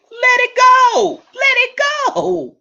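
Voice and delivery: high, slow pleading let it go; high, slow, exasperated plea. Retold or imitated. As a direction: stretch it out, begging her to stop. Urging the friend.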